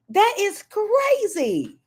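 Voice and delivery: high, drawn-out disbelief; stunned high exclamation; incredulous outcry. Real, not acted. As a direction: pitch it way up and stretch it. Outraged reaction to a look-alike photo.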